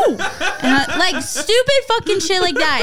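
ordinary talk, nothing marked

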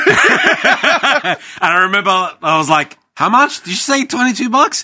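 high loud astonished voice; mock amazement. Reacting to the cheap restaurant bill, high astonished. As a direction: gasp it out in disbelief.

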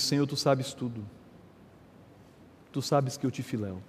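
quiet, contrite answer to Jesus; humbled answer. Acted. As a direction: let Peter's reply sink, hurt and humble. Peter's humbled third answer.

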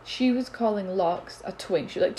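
ordinary talk

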